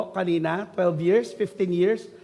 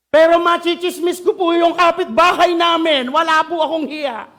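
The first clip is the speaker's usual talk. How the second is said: louder and higher, proudly shameless; loud gossipy boast; 6 semitones higher, 6 dB louder than usual. Acted. Preacher mocks a gossiping churchgoer, loud boast. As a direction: brag about gossip with no shame.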